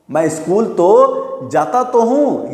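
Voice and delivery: slowed, with weight on तो; teacher showing emphasis on तो; emphatic stress. Shown as a demonstration. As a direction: lean on each तो. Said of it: "मतलब जोर लगा के बोलना".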